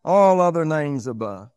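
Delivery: loud, high pulpit delivery; full-force preaching. Real, not acted. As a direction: preach it out strongly, high and emphatic.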